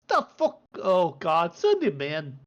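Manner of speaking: loud squawk, then a resigned grumble; exasperated dwarf. Acted. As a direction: disbelief settling into weary consent.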